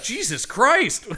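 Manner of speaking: high, drawn-out exclamation of disbelief; mock exasperation. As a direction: cry out in mock dismay, loud and slow. Acted shocked reaction to dad-like outfit.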